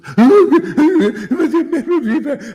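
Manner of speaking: high, loud scornful cackle; mocking laugh. Real, not acted. Preacher laughing at wimpy followers, a loud high scornful laugh. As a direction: burst out in a shrill sneering laugh.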